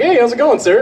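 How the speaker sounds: a neighbour's overly polite hello; mock polite greeting. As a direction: greet a stranger with put-on politeness. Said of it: "be like".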